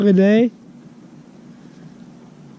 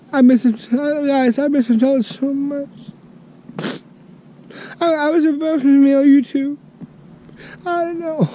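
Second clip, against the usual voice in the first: choked-up crying over a missed friend; struggling to speak through tears; real crying; 7 semitones higher than usual. Real, not acted. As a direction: voice breaking high and tearful. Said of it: "because I'm crying right now".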